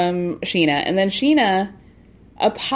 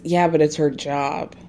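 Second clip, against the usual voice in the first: snide mutter; 6 semitones lower than usual. Retold or imitated. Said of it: "not exactly under his breath".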